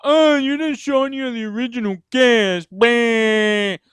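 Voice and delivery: put-on whiny voice of upset fans; mock complaining fans. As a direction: mimic the complainers, then blah it away. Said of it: "they didn't sound like that".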